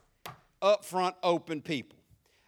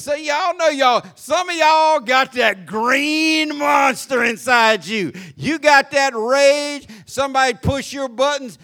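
preaching shout; 4 semitones higher, 9 dB louder than usual. Acted. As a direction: preach on rage at full force. Preaching on rage.

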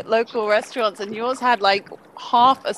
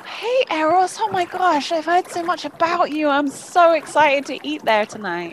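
gushing fan; 7 semitones higher than usual. Acted. Gushing to restaurant owner.

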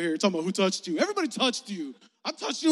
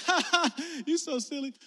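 higher, louder teasing fake laugh; mocking laugh; 8 semitones higher, 3 dB louder than usual. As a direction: ham up a crowd laughing at him. Crowd laughing at Jesus.

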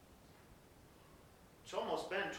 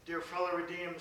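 normal talking voice

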